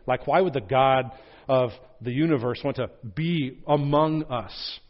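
ordinary talk

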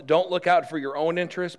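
ordinary talk

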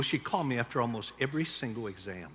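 ordinary talk, nothing marked

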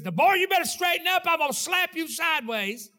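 put-on a parent snapping at the boy; angry parent shout. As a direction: parent yelling at his son, furious. Angry parent scolds child.

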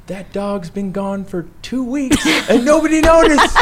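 high, loud mock outburst; exasperated dad yelling. Dad revealing the truth to his sons, mock exasperated yell. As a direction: imitate a fed-up father at full pitch.

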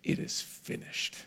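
a breathless whisper, barely there. Acted. Preacher voicing dying Jesus on the cross, whispered. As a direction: let the last words escape as a whisper.

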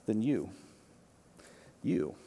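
hushed Jesus, leaning in to each person; close and quiet. Retold or imitated. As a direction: speak as if right beside one listener. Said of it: "leans in real close to and says".

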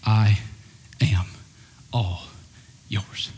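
heartfelt surrender. Shown as a demonstration. Pastor modeling a prayer to the church, tender and slow. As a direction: breathe each word out like a vow. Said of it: "from the bottom of our heart say these four words".

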